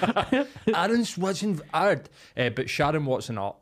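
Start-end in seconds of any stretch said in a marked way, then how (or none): 0.0-2.1 high, slow, grand announcement of the name; hammed-up fanfare reading of the name; drawn-out name reveal. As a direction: stretch it out like a big reveal.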